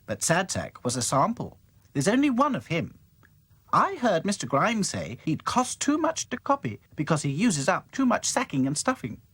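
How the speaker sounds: bright doll explaining; Dotty voice. Acted. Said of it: "explained Dotty".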